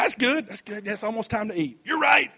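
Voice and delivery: normal talking voice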